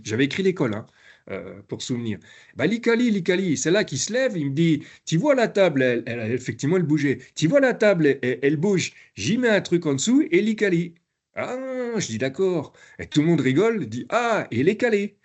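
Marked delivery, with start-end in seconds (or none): 2.6-10.9 higher and animated, a light Algerian lilt explaining the wobbly table; Algerian French accent. Retold or imitated. The trainer voices the learner explaining the table, in a light Algerian accent. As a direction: imitate him respectfully, not as a caricature. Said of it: "comme je vous le fais là".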